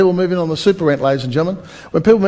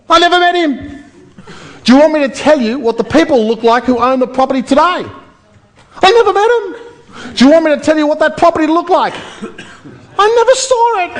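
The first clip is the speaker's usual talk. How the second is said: mock-innocent squeak; 10 semitones higher, 4 dB louder than usual. Teasing the seminar crowd about owners he never met, a high squeal. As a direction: jump way up high for each punchline. Comic punchline, denying meeting owners.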